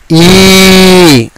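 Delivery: ఈ held out long and level; drawn-out ఈ, teacher demo; long vowel ee. Shown as a demonstration. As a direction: stretch ఈ into a long steady ee. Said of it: "ఇక్కడ జాగ్రత్తగా చూడండి".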